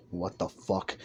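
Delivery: low, soft disbelief; baffled muttering. Acted. Aizawa reacting to the scene, low baffled mutter. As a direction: say it under your breath.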